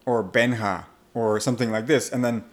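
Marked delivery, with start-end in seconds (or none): 0.2-0.8 Benja as "BEN-ha"; Spanish pronunciation. Shown as a demonstration. Coach offering a short Spanish name, Benja pronounced BEN-ha. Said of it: "Spanish pronunciation of my name".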